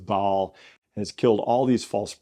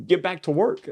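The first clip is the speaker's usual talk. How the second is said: higher-pitched, mildly exasperated command; exasperated order; 8 semitones higher than usual. Retold or imitated. God sending Elijah back to his task, exasperated. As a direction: send them off, voice lifting at the end.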